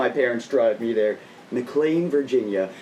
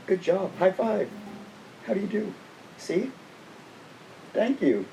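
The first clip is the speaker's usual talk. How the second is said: pet talk; 7 semitones higher, 7 dB quieter than usual. Real, not acted. Praising pet Finn.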